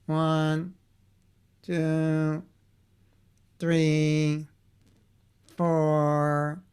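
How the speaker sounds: counting slowly in an even robot monotone. Acted. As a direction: toneloze robotstem. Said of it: "becoming a robot yourself".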